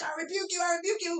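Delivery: high, loud cry of rebuke; rebuking a spirit. Retold or imitated. Woman rebuking the witch in her dream, high crying shout.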